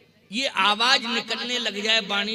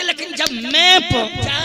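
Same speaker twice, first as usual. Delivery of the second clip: मैं hit loud and higher with pride; arrogant, forceful मैं in लेकिन जब मैं पहुंचा; stress on मैं; 6 semitones higher, 8 dB louder than usual. Shown as a demonstration. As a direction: puff up and punch the word मैं. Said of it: "शब्द पर जोर मत दो".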